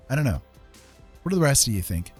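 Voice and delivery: normal talking voice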